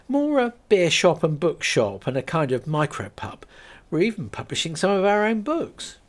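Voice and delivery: keen to explain the idea; Kate voiced, explaining. Kate explains the beer shop idea to the lady, keen.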